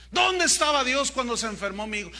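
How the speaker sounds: indignant grieving parent. Retold or imitated. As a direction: hurl "¿Dónde" as an accusing demand. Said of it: "Es la pregunta típica". (padre dolido que reclama, "¿Dónde" fuerte y acusador)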